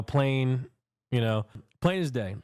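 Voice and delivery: ordinary talk, nothing marked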